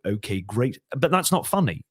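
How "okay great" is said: accurate, understated impersonation, low and measured; Boris Johnson. Shown as a demonstration. Impressionist shows the accurate but unfunny version, understated. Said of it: "Boris would talk like this".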